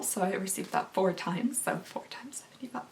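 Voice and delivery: hushed whisper. Real, not acted. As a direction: whisper it like a secret. Whispered aside about the cost.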